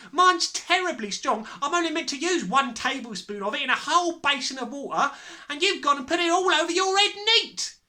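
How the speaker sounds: high, loud scolding; crying out. A narrator's reading. Mother berating her foolish husband, crying out. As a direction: scold him at full tilt. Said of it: "the mother cried".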